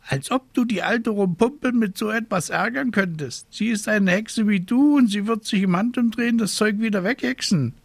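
high, fussy scolding raven voice. A narrator's reading. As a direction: play a worried, scolding raven. Raven scoffs.

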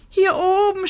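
very high shouted call; screaming. A narrator's reading. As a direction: shout down with all your might. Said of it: "schrie sie so laut".

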